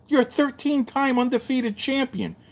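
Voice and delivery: you can't be serious; disbelief. Retold or imitated. Retold disbelief at Bill.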